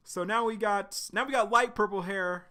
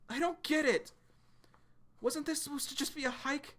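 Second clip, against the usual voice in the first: put-on scared kid's voice; 10 semitones higher than usual. Scared kid voiced in story.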